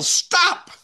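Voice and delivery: sudden high-pitched call to halt; mock yell. Pastor pretends to chase a listener down the street, mock yell. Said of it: "I yell".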